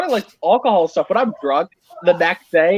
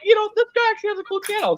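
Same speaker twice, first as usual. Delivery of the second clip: much higher, quick, impressed; high quick inner voice; faster than usual. Retold or imitated. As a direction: toss it off high and fast. Retelling his change of mind.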